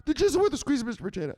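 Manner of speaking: high, strained underling cry. Acted. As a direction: push it up high, still obedient. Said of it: "Say it louder".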